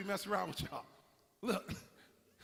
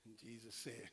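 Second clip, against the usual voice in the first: somber hush; 8 semitones lower, 15 dB quieter than usual. Acted. Somber retelling of Peter's shame.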